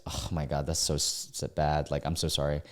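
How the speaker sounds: real soft sympathy; sympathetic. Real, not acted. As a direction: feel for him, softly. Sympathizing with the poster.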